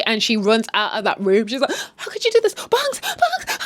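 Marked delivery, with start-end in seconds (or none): 2.0-3.6 high, crying mock hysterics; mock wailing outburst. Upset girl runs out of that room, mock wailing. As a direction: act out a distraught, tearful protest.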